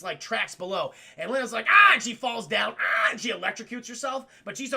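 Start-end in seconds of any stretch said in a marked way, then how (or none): 1.7-1.9 short imitated yelp; mock falling scream. Lena falling onto the tracks, mock scream. 2.8-3.1 short loud yelp; mock shock scream. Lena electrocuting herself, loud mock yelp.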